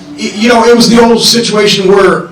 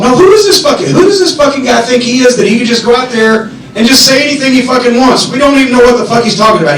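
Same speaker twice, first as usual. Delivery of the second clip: much higher, building yelled rant, fast at the end; furious rant; 5 semitones higher than usual. Retold or imitated. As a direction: work yourself into a rage. Flair working himself up.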